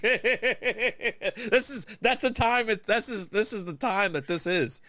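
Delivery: high, far-off goofy voice; lightly put-on silly voice; mock silly voice. Friend retelling his own words in a mock voice.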